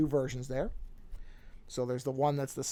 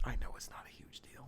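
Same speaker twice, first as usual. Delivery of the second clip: whispering; 3 semitones lower, 13 dB quieter than usual. Real, not acted. Muttering aside to himself.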